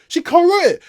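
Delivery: exasperated. Real, not acted. Exasperated repeat about co-writer.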